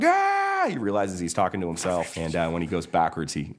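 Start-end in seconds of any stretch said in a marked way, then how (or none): 0.0-0.7 high, loud mock scream; freaked-out scream. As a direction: scream in cartoon panic. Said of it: "Mater freaks out".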